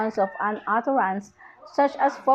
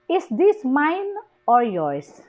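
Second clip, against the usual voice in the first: slow, up on "mine", down on "yours"; rise-fall intonation; 3 semitones higher, slower than usual. Shown as a demonstration. Teacher demonstrating a choice question, rising "mine" and falling "yours". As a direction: raise "mine", then drop "yours". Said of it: "rising, rising in the".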